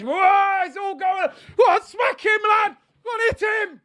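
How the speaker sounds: very high, loud shouts egging on the fight; real excited shouting. Real, not acted. Fan cheering a scuffle on the pitch, high excited shout. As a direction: shout with glee as the brawl kicks off.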